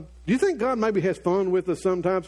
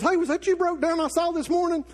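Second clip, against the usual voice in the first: teasing friend; 8 semitones higher than usual. Retold or imitated. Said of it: "He calls me later and says".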